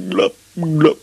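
high, loud bubble pops; comic underwater blops; bubbling imitation. A narrator's reading. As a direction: pop each "blop" like water bubbles. Ivan going under water.

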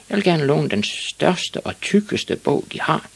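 put-on a kid making a big request, pitched high; little boy Ivan. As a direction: stretch it out in a high child voice. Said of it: "sagde han til damen".

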